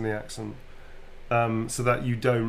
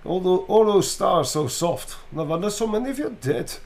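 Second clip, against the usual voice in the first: Russian accent placed mid-mouth; 7 semitones higher than usual. Shown as a demonstration. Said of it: "Russian is the middle of the mouth".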